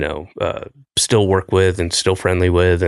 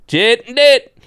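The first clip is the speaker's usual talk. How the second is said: bigger Southern accent; 5 dB louder than usual. Shown as a demonstration. Host hamming the tagline in an even broader Southern accent. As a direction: push the Southern accent further. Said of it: "And even more".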